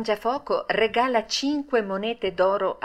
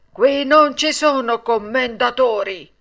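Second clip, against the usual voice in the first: loud, stern, raised rebuff; harsh reply; 5 semitones higher, 5 dB louder than usual. A narrator's reading. Puppet master rejects yet another title, harsh and loud. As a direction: throw the title back sternly. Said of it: "replicò duramente il burattinaio".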